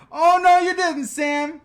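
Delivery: loud, high sassy oh no you didn't. Retold or imitated. Reading a viewer's comment aloud, sassy and loud. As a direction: read it with sass, voice up.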